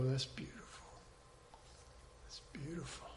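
very soft, near-whispered awe; imagined listeners' hushed wonder; hushed admiration. Acted. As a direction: murmur it as if deeply moved. Voicing future believers moved by story.

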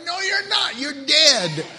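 loud, high emphatic contradiction; shouted rebuttal. Acted. Preacher shouts down a doubter's objection, emphatic rebuttal.

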